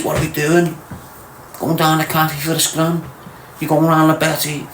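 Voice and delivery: a hint of a lazy scally asking what's on; mocking the lads. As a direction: sketch a bored lad on the phone to his mates, half-mocking. Mocking lads ringing their boys.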